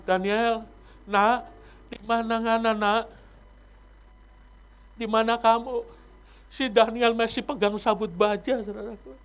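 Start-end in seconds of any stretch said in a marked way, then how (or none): 0.0-5.9 gentle call. Retold or imitated. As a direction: call him kindly, like a loving father. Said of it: "tapi sekarang".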